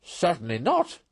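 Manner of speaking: both words hit hard and high; emphatic. A narrator's reading. Toad flatly refuses the Badger, emphatic and high. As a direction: slam the refusal down. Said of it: "replied Toad emphatically".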